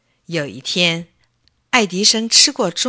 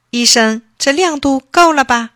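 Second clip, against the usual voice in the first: put-on higher, louder eager boy's question; proud child; 6 semitones higher, 7 dB louder than usual. Boy asking the doctor if it's bright enough, eager and proud. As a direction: ask proudly like a pleased little boy.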